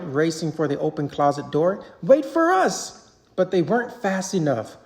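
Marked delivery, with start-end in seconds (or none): 2.0-3.1 high, slow shout after someone; desperate call. A narrator's reading. Mike and Sully chasing George, high shout. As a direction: call out as he slips away.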